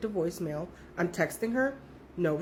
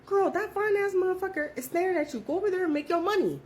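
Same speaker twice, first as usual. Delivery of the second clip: high, teasing push to go get him; urging a friend toward a client, pitched high; egging her on; 9 semitones higher than usual. Retold or imitated. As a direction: hype up a coworker, pitched up. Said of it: "I'm like".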